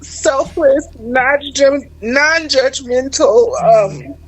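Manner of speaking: fighting tears as she praises her mom; holding back tears. Real, not acted. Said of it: "that's why I'm holding back tears".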